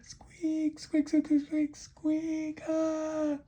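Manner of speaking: very high, frantic loud squealing; piercingly high alarmed squeaks; panicked mouse. Acted. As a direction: squeal like a mouse caught by the tail. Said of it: "And the mouse said".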